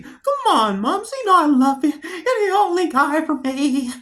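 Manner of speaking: lightly hammed-up wheedling; movie character pleading. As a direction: plead in a put-on high voice. Acting out the film's gangster scene.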